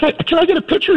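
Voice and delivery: high excited fan voice; starstruck fan lady. Retold or imitated. Older lady asking the coach for a photo. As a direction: chirp it like a giddy fan. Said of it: "she's like".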